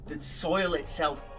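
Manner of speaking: ordinary talk, nothing marked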